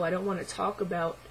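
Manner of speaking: normal talking voice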